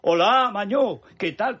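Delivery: loud, high-pitched Zaragoza greeting, hammed-up; mock Zaragoza accent. Host mocking how politicians in Zaragoza greet people, hammed-up Zaragoza accent. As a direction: exaggerate a Zaragoza hello, pitched well up. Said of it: "Pero si nadie habla así".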